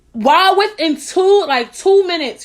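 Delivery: slow, high, loud amazement at the quick reply; drawn-out incredulous emphasis; emphatic disbelief. Real, not acted. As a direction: stretch it out in disbelief.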